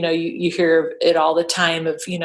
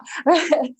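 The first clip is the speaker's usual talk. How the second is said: short laugh; 10 semitones higher than usual. Real, not acted. Laughs at CrossFit joke.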